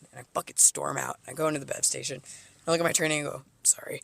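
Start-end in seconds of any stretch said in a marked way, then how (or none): 3.6-4.0 whispered sorry. Retold or imitated. Aside to trainee.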